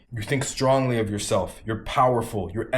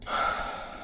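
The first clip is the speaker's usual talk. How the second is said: long airy exhale after a deep breath; 7 dB quieter than usual. Shown as a demonstration. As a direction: let the breath go slowly, with no voice. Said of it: "step outside and take deep breaths".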